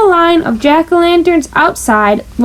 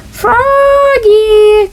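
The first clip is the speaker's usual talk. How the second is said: high, drawn-out teacher's call; 7 semitones higher than usual. A narrator's reading. Miss Witherspoon calls Froggy across the pumpkin patch. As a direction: call him back like a patient teacher. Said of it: "called his teacher".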